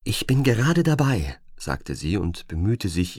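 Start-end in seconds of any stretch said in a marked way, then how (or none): 0.0-1.4 high, louder show of busy eagerness; forced eagerness. Acted. Agent tries to sound eager to the debt collector. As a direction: force a can-do tone over fear. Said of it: "ihre Stimme tatenfreudig klingen zu lassen".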